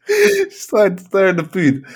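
slow down and squeal it through real laughter; cracking up. Real, not acted. Telling co-host about the waitress, cracking up. Cracking up while retelling restaurant story.

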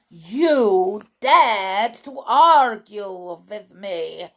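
put-on slow, loud, accented outrage; enraged witch. A narrator's reading. Witch leader rounds on the doubter, slow loud fury. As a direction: thunder it slowly, drawing out each word. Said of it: "screamed the Grand High Witch".

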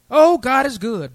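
loud, slow, drawn-out proclamation; exultant praise. Real, not acted. Preacher to congregation, loud slow exclamation. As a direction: lift it up slow and strong.

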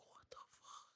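barely voiced, whispered disbelief; stunned whisper. Driver hearing Dan Levy is coming, stunned whisper. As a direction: whisper it in retold shock. Retelling stunned reaction to star news.

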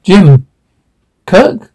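ordinary delivery, nothing marked

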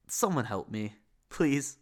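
ordinary talk, nothing marked

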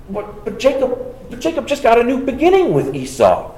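ordinary talk

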